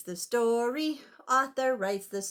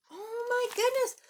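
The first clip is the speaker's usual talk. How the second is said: excited; 11 semitones higher than usual. Acted. Excitement at chase.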